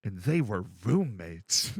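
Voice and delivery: deadpan, low and drawn out; deep slow roommates line. Retold or imitated. Retelling the Vine punchline to a friend, low and slow. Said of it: "and then he just".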